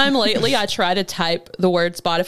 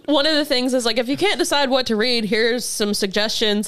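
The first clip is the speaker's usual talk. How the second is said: ordinary talk, nothing marked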